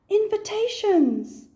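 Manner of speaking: high, loud, drawn-out cry; the forgotten invitations; sudden realisation. Acted. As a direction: gasp of remembering. Sudden remembered thought.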